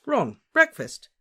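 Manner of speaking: high, loud, drawn-out call. A narrator's reading. Harry calls his friend to breakfast, calling out.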